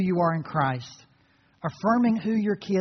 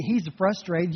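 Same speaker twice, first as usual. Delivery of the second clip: ordinary talk, nothing marked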